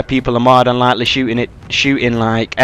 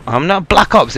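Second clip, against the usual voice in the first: normal talking voice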